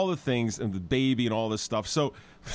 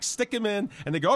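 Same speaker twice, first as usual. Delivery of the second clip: ordinary delivery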